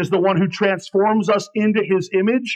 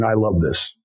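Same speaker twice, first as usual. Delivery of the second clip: ordinary talk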